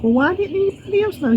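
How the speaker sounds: very high-pitched retold puzzlement; squeaky-high, exaggerated bewilderment; mock bewildered protest. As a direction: replay your baffled question, voice way up. Retells her puzzled protest to agent.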